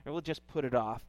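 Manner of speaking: a dad softly comforting his daughter Leah; soothing daughter. Retold or imitated. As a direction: ease her panic kindly. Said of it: "you just wanna say".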